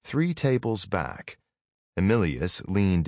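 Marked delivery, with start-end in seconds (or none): none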